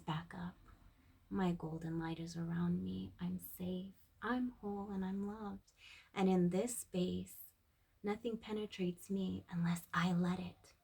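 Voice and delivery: soft, hushed affirmation sliding into a whisper; quiet self-soothing mantra; loving affirmation. Retold or imitated. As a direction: reassure yourself tenderly and quietly. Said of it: "you lovingly say".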